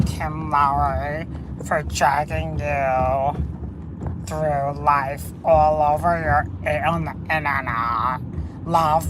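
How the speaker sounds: lightly voiced low, slow sarcastic reading; mocking read of the post. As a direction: read the fake apology low and slow, with mild scorn. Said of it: "went online and wrote".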